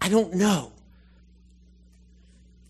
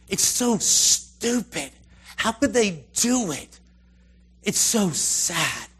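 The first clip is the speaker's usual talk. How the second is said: higher, louder, slow exasperation; exasperated; 4 semitones higher, 4 dB louder, slower than usual. Real, not acted. Preacher lamenting a foolish people, exasperated. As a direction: stretch out the disbelief.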